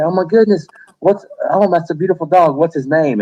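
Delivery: high, gushing admiration for the dog; a stranger gushing, pitched high and sweet. Retold or imitated. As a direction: coo over the dog like a smitten stranger. Strangers gushing over service dog.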